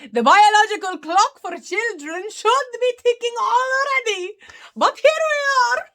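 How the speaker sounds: high, loud, playful mock-tragic sigh over her own age; mock lament. To her live chat about the clock, mock high-pitched lament. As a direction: ham up the despair with a wink. Joking about being thirty and childless.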